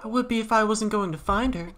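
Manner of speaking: lightly voiced queen. Queen ordering Chris to drive, lightly voiced. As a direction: play the queen giving orders.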